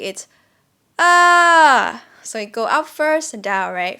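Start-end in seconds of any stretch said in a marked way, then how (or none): 1.0-2.0 a loud ah that goes up then drops; rising-falling ah. Shown as a demonstration. Teacher showing the shape of the falling tone, loud. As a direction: sing the falling tone on one vowel. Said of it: "Falling tone, um, phonetically".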